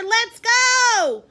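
loud, high, urging shout; calling out. A narrator's reading. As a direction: holler to hurry him along. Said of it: "called his father".

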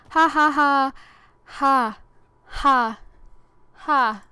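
loud, spaced-out mock laugh, one "ha" at a time; deliberate sloth laugh with pauses between each ha; Flash's slow laugh. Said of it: "it's him and Nick talking".

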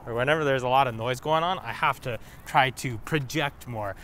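ordinary talk

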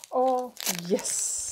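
high, drawn-out delighted cry. Real, not acted. Vlogger finds a wished-for product in the parcel, delighted.

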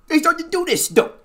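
high, loud put-on gangster voice; mock tough guy talk. Mocking girls who copy rough rap talk, gangster voice. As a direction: ham up a rough street voice. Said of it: "imitate guys".

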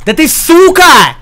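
high, slow drawn-out yell; angry yell. Real, not acted. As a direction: drag out the curse. Curses enemy in game.

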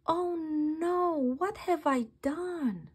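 panicked little gasp of realization; dismayed. Acted. Slug realizes mistake.

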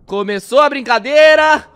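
loud, slow, ominous announcement; dramatic announcement. Real, not acted. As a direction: drag out the dread as the horror starts. Reacting to a creepy horror cartoon.

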